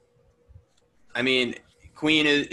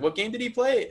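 higher, puzzled question to mom; retold question; 11 semitones higher than usual. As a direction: ask it like you're lost. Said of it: "I was like".

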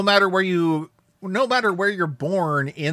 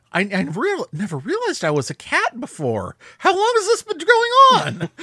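crushed; 11 semitones higher than usual. Retold or imitated. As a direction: play stunned hurt rising into panic. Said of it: "He just sounds absolutely crushed".

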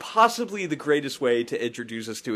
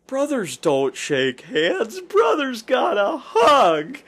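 slow, building to a tearful shout; emotional shout; 12 semitones higher, 5 dB louder, slower than usual. Retold or imitated. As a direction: cry out the line slowly. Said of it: "classic line".

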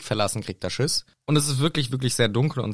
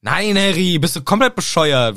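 indignant retort; 6 semitones higher, 5 dB louder than usual. Retold or imitated. Hagrid indignant reply.

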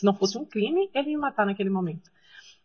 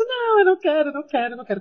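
high, tearful cry, easing off; retold desperate screaming; 6 semitones higher, 3 dB louder, faster than usual. Child begging father and mother, retold desperate screaming. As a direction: wail it like a panicking child, then let it fall. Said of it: "Comecei a gritar desesperadamente".

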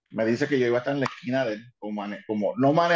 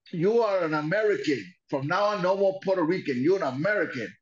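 a hint of a raised ranting voice; lightly voiced ranting wrestler; mock wrestler voice; 5 semitones higher than usual. Imitating Dick Murdoch declaring him American.

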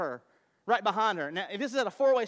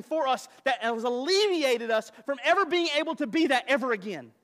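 preaching; 4 semitones higher, 7 dB louder than usual. Real, not acted. Pastor exhorting the congregation, loud and emphatic. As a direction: build it up with full force.

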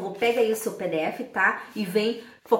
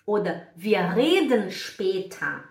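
usual speaking voice

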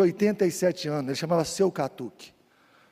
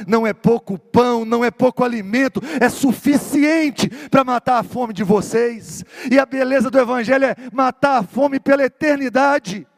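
loud, raised preaching voice, swelling on each phrase; full-force preaching; 7 semitones higher, 6 dB louder than usual. Acted. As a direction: preach it to the whole congregation, big and ringing. Pregação em plena força.

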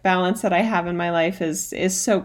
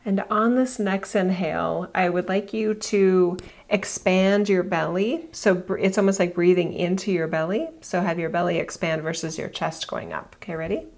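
guided meditation. Acted. Leads a breathing meditation.

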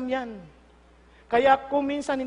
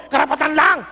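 indignant; 10 dB louder than usual. Pretend. Entitled person voiced.

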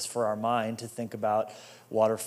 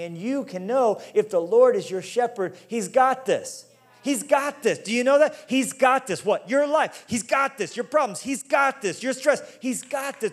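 higher and louder, driving home each line; preaching at full force; 8 semitones higher, 5 dB louder than usual. Acted. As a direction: build up like a fired-up preacher.

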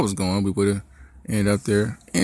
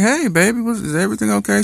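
much higher, quick, anxious check-in; worried parent; 13 semitones higher than usual. Retold or imitated. Dad answering his daughter's urgent call, high and anxious. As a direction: rush it out, trying to sound calm but worried.

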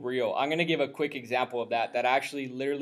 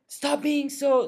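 mock yelling; 11 semitones higher, much slower than usual. Coffee shop worker scolding customers, high mock yell. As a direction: imitate an angry scolding in a raised pitch. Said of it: "she started yelling at us".

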